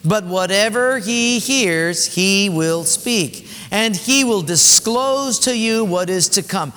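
slow, weighty reading of scripture; measured scripture reading. Shown as a demonstration. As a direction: read the verse aloud with weight. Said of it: "John sixteen, go".

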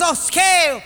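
high-pitched, emphatic examiner; acted personnel man, insistent; brash quizmaster. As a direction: put the question like a brash game host. Personnel guy asks again.

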